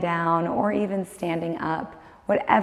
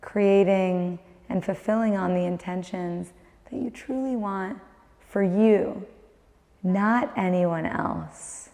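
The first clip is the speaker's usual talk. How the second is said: guided meditation; 6 dB quieter than usual. Acted. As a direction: guide the listener inward, calm and unhurried.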